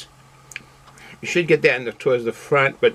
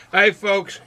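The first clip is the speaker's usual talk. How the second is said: laughing; 6 semitones higher than usual. Real, not acted. Laughs after whoop.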